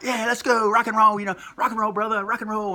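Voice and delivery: high, loud hyped-up rock and roll; pumped wrestlers. Retold or imitated. Rock and Roll Express charging in, pumped. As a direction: hype the crowd. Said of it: "They're like".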